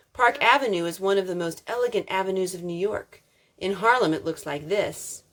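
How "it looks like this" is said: plain reading voice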